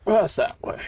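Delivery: tiny squeaky playful voice; squeaky high voice. Pretend. Repeats his own remark in jest.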